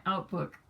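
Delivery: joking slip of "outback", last vowel as in "book"; mock Australian "outbook". Shown as a demonstration. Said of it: "That was my Australian accent".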